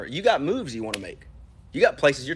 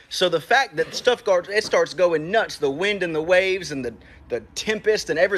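a preacher building the storm, getting higher and more fired up; preaching, rising in pitch; 4 semitones higher than usual. Acted. Preacher to his church about the storm, rising higher. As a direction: climb higher with every line as the boat starts sinking.

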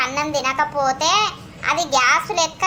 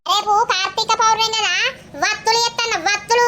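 shouting to deaf neighbour; 5 semitones higher than usual. Acted.

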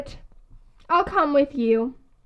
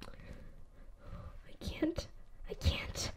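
breathless whisper, almost no voice; scared whisper; 19 dB quieter, much slower than usual. Acted. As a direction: whisper it, too frightened to speak up. Frozen with nerves, whispering.

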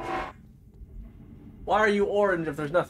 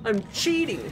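protesting outburst; 9 semitones higher than usual. Real, not acted. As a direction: exclaim it high, sliding down on each phrase. Catches themself cheating while climbing.

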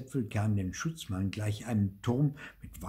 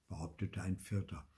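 plain reading voice

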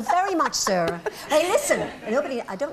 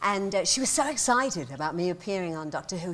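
normal talking voice

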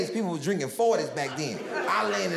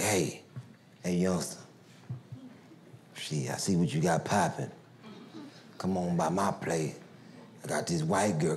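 much lower, laid-back hustler voice; imitated dealer; 12 semitones lower, 3 dB quieter than usual. Dealer inviting the teen over, low and smooth. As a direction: drop way down and lean in smooth.

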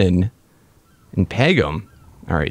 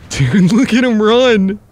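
excited; 7 semitones higher, 4 dB louder than usual. Real, not acted. Delighted at fleeing enemy.

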